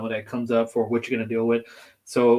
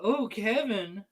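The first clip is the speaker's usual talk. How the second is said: imitating Marcus; 9 semitones higher, 4 dB quieter, slower than usual. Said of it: "The way Marcus be like".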